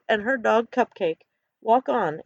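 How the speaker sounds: plain reading voice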